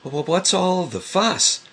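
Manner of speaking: high, timid stammering little voice; small piglet voice stammering from the doorway. A narrator's reading. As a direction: ask it small and nervous. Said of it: "asked a very small voice".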